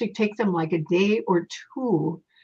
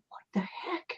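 hushed, breathless what the heck; startled whisper; 9 semitones higher, 13 dB quieter than usual. Retold or imitated. Medium thinking to herself on seeing the bloody ghost, startled whisper. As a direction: whisper it in shock at the sight.

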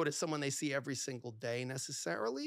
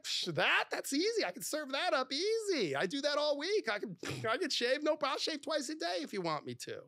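high-pitched, cocky enthusiasm; acted-out flattered boyfriend, pitched way up; eager boasting; 11 semitones higher than usual. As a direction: brag like it's no trouble at all. Acting his eager, flattered response.

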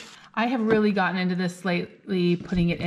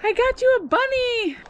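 put-on high child voice; 4 dB louder than usual. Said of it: "He's like".

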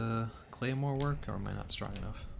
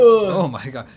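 loud outburst; 9 semitones higher, 19 dB louder, slower than usual. Real, not acted. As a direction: shout of disgust. Disgusted by friend's burp.

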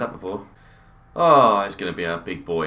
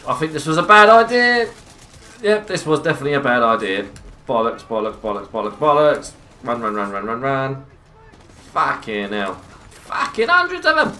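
panicked, loud and high; being chased; 4 semitones higher, 7 dB louder than usual. Real, not acted. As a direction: flee in a rising panic. Swarmed by enemies, fleeing.

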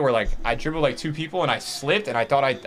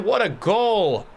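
slow, stretched admiring echo; drawn out awe; 6 semitones higher, much slower than usual. Real, not acted. Streamer savouring the goal, drawn-out awe. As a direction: savour it slowly.

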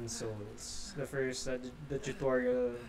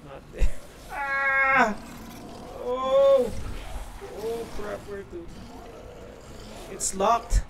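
scared horror game streamer; 8 semitones higher, 6 dB louder, slower than usual. Real, not acted. Chased, finds locked door.

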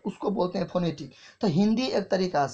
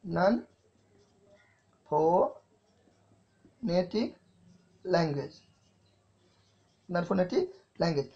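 slow dictation; slower than usual. Shown as a demonstration. Teacher dictating the term to the class, very slow. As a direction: dictate each word slowly for notes. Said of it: "फिर एक होता है non phonetic language".

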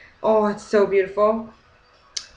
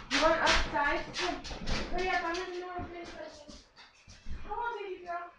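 much higher, fast coaxing pet voice; talking to the dog; 9 semitones higher, 10 dB quieter than usual. Real, not acted. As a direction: coax the dog outside. Talking to her dog Ruth.

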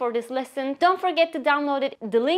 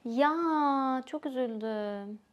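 sad; 6 dB quieter, much slower than usual. Shown as a demonstration. Hearing a friend's dog died, softly sad. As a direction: sigh out the ya in sorrow, much softer. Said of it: "show that you are really sad".

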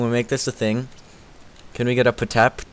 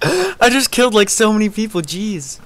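high, louder excited rush; 10 semitones higher, 4 dB louder than usual. Real, not acted. Bragging to chat after many kills, real excitement. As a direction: gush about the kills. Big win streak.

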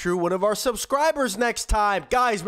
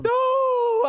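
high crying shout; 12 semitones higher than usual. Acted. Dad losing the fight.

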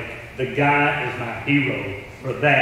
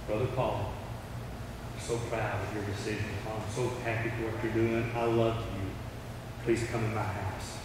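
softer, lower gentle welcome; tender welcome; 3 semitones lower, 10 dB quieter than usual. Acted. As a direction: welcome him gently, forgiving. Philip welcomes Paul tenderly.

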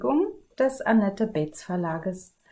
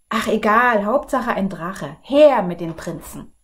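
louder, brushing it off; eager king; 7 dB louder than usual. Acted. King impatiently demanding princes, loud. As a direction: wave it away and call for princes.

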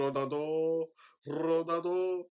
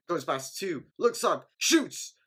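higher and louder toward the shot; building call; 5 semitones higher, 6 dB louder, slower than usual. Real, not acted. Fan calling Ronaldinho's shot, rising and louder.

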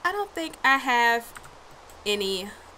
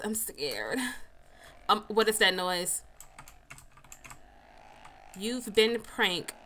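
usual speaking voice

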